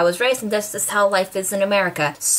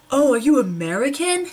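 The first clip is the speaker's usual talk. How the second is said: high, rising mock question; surprised stranger; 6 semitones higher than usual. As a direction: mimic a stranger's nosy question. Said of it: "and they're like".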